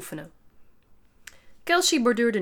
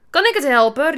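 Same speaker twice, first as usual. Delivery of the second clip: loud, higher protest; indignant raised-voice retort; defensive shout; 6 semitones higher, 11 dB louder than usual. A narrator's reading. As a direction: cry out in self-defence. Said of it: "riep Kelsey".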